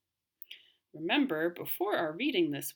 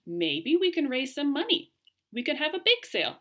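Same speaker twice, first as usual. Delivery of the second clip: eager suggestion; 9 semitones higher, 5 dB louder than usual. Acted. Hal suggests bake sale eagerly.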